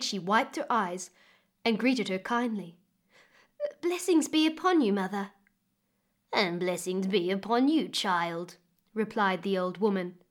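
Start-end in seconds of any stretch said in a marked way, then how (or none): 3.8-5.3 put-on a lightly higher, brighter young girl's voice; a young girl greeting a stranger, voiced a touch higher; girl greeting politely. May greeting the odd old woman, a lightly higher girl's voice. 6.3-8.6 a lightly voiced old woman, a bit lower; old woman answering. Old woman greeting the weeping May, lightly lower old voice.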